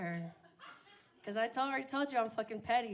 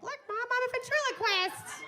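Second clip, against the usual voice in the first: much higher, squeaky puppet voice; silly ventriloquist voice; 13 semitones higher, 6 dB louder than usual. Pretend. Taunting mom with a dentures gag, squeaky high. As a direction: pipe it up like a dummy.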